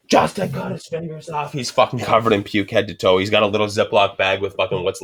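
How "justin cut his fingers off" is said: higher, softer dopey voice; a lightly voiced friend blurting bad news; higher, softer blurted report; mock report.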